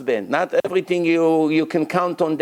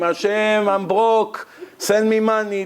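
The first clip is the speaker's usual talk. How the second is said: mock scream; 5 semitones higher than usual. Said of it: "this guy scream".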